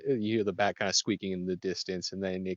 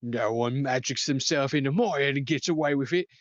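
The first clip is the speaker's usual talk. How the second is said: higher, indignant threat; angry rider; 6 semitones higher, 3 dB louder than usual. Acted. Rider threatening the telepath, a bit higher and indignant. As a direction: warn him off with pride. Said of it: "in your head".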